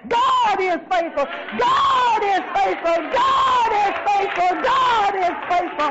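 high, building shout, each repeat stretched out; full-force preaching. Real, not acted. Preacher proclaiming to the church that God is faithful, building shout.

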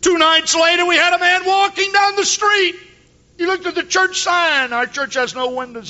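high, loud preacher's cadence; fiery sermon voice; full-force preaching. Acted. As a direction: preach it to the rafters.

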